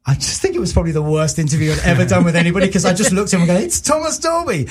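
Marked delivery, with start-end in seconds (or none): 3.6-4.7 much higher, awestruck fanboy blurt; starstruck gasp. Retold or imitated. Fan meeting his hero [name], starstruck and much higher. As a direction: gush his hero's name like a starstruck fan.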